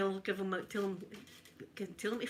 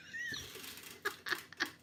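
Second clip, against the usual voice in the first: breathy high laugh; 8 dB quieter than usual. Real, not acted. Laughing at own story.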